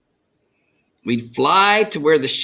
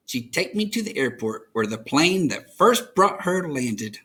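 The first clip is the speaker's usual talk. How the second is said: ordinary delivery, nothing marked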